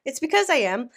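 breathless from being sick; a little winded while talking; out of breath. Real, not acted. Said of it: "apologies in advance if I sound out of breath".

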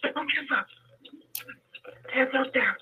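hoarse, breaking voice fading to a whisper; weak voice that drops to a whisper; voice going in and out. Real, not acted. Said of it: "you have to excuse my voice".

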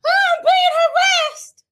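high, loud hammed-up wail; mock crying. Mimicking a woman crying to her camera phone, mock wailing. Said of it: "she starts crying".

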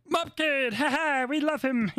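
high-pitched, giggly caricature of a friend; Kendall impression. Host imitating a friend who loves Mop Kid, high giggly impression. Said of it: "That was a really good impression of me".

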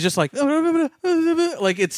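put-on high-pitched, rapid childlike babbling; exaggerated high, childlike jabber; Tom Holland impression. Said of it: "rapid childlike pacing of talking".

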